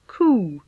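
"Coo" with a long, held U; long vowel. Shown as a demonstration. Teacher models long U for learners, drawn-out "Coo". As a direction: draw out the oo in "Coo". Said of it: "long version of this".

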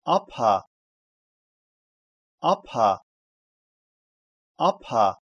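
"апа" with a voiceless, puffed "п"; read as "апа". Shown as a demonstration. Teacher reading out a letter combination, "апа" with voiceless "п". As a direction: say "апа" in two distinct syllables. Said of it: "читается".

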